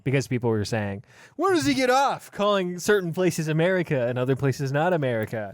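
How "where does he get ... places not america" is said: high-pitched imitation of an outraged complainer; hammed-up indignant complaint; mock indignation. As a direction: mimic an affronted listener, starting high. Mimicking listeners' angry complaints.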